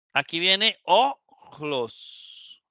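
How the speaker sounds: Aquí viene ojlos stretched very slowly; slow introduction. Shown as a demonstration. Teacher slowly presenting a Greek word to students. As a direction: draw out ojlos with a throaty j. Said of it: "teacher introducing word".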